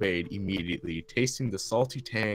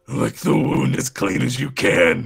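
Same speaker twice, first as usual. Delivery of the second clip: Batman voice; 5 semitones higher, 10 dB louder than usual. Acted. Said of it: "it just kinda sounds like Batman".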